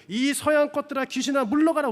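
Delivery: acting out an angry mother, high and loud; yelled abuse. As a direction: drive them off with a mock furious yell. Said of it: "엄마가 막 욕을 지르면서".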